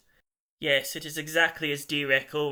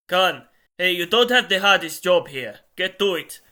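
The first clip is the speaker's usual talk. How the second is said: raised, higher-pitched telling-off; loud scolding; 4 semitones higher, 12 dB louder than usual. A narrator's reading. Telling Khan to get to work, loud scolding. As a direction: call him out loudly.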